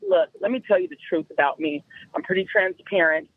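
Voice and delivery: low, level confession in his voice; retold man's warning. Man warns new girlfriend about himself, low and matter-of-fact. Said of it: "So then he's like".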